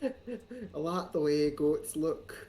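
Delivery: slow, drawn-out quote of the goat line; slow mocking quote. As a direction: savour each word to mock him. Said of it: "clip Cog saying".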